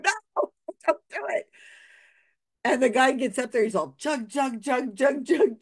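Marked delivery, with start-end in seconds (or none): 0.0-1.5 higher, pleading no from the audience. Retold or imitated. Audience begging drunk not to chug, high pleading. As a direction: beg him not to. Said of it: "We're going".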